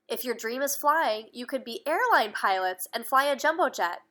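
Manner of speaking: lively read-aloud storytelling voice; story narration. Acted. Parent reading a picture book to a child, lively storytelling.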